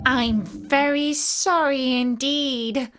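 put-on high, slow girlish apology; small girl, mildly alarmed; frightened apology. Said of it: "who was truly frightened".

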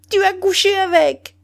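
high, loud frenzied yell; screaming. A narrator's reading. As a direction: scream the accusation wildly. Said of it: "Elle hurla".

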